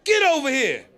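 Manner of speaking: high shouted call to a dog. Retold or imitated. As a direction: yell for the dog from the doorway. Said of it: "he like".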